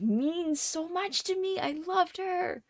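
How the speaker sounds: real crying creeping in, pitch jumping high on loved; choked-up confession; voice breaking, near tears. Real, not acted. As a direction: hold back tears as it wells up. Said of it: "now I'm gonna cry".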